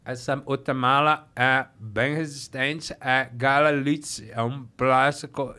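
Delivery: very slow, low, laboured words; stroke-slurred speech. Retold or imitated. Luggage salesman to a browsing customer, stroke-slurred speech. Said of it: "teve um derrame".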